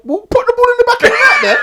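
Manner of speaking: high, loud outburst; exasperated retort. Real, not acted. Arguing with rival Liverpool fans over missed chances, exasperated shout. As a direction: shout it back, pitch shooting right up.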